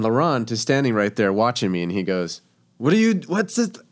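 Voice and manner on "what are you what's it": put-on high-pitched French-accented chef. Head chef catching cook cutting duck, French accent. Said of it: "in his French accent".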